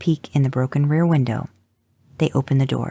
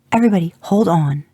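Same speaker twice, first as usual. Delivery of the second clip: loud, raised warning to the car; urgent call; 5 semitones higher, 5 dB louder, faster than usual. A narrator's reading. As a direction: brace everyone before the crash. Mother warns before flooring it.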